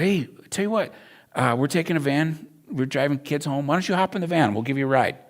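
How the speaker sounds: put-on high, loud, bright-eyed offer to a kid; cheery invitation. Volunteer calling cheerfully to a little girl, high and loud. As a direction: sell the ride with upbeat friendliness.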